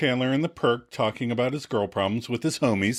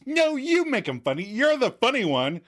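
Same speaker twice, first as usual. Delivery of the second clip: much higher, giddy insistence; excited arguing; 13 semitones higher than usual. Retold or imitated. Katie insisting to Joey he's funny, excited arguing. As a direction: argue back excitedly, pitched right up. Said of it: "Katie excitedly argues".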